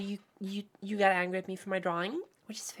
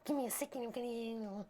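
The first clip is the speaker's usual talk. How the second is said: whispered aside; 7 dB quieter than usual. Real, not acted. Aside while flustered.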